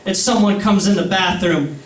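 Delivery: ordinary delivery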